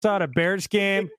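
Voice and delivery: slow put-on Chicago accent. Shown as a demonstration. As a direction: stretch it out like a Chicago fan. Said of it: "has the best Chicago accent".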